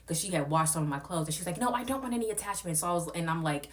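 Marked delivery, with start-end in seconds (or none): 1.6-2.8 mocking the host. Voicing the host.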